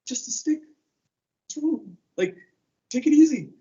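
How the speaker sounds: high, offhand scoff; imitating a flippant young man, pitched high; dismissive youth. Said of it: "And I'm like".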